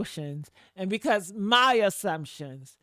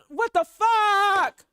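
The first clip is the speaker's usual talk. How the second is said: loud, high, drawn-out exasperation; outraged outburst; 11 semitones higher, 7 dB louder, much slower than usual. Retold or imitated. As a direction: cry it out and stretch the last word. Venting her anger over friend.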